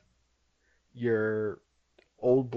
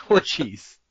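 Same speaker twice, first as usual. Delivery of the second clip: put-on French accent; 7 dB louder than usual. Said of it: "[name]'s French accent".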